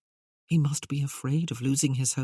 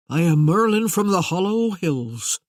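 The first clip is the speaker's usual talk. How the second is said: grand Merlin voice; 3 semitones higher, 6 dB louder than usual. Acted.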